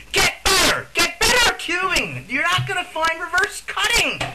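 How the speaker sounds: high, loud frustrated yell. Real, not acted. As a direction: shout it at him, fed up. Ranting at the reviewer.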